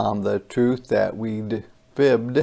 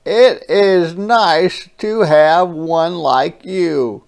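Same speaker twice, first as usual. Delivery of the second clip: tender brother; 6 semitones higher, 7 dB louder than usual. Acted. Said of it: "a smile that was new".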